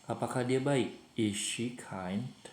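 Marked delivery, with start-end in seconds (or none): 1.2-2.4 slow, deliberate English question; slow model question. Shown as a demonstration. Teacher shows pupils the moved verb, said slowly. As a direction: spell out the question slowly for the class. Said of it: "Is-nya dikedepankan".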